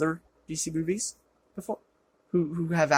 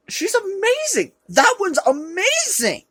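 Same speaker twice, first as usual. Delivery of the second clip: much higher, slow delighted squeal; gushing squeal; 14 semitones higher, 4 dB louder, slower than usual. Real, not acted. Gushing over comic artist.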